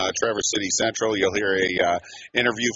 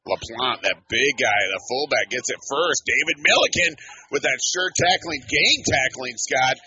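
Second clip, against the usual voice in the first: higher-pitched, excited football call; sportscaster crediting a big tackle; live play-by-play; 5 semitones higher than usual. Real, not acted. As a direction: punch out the tacklers' names.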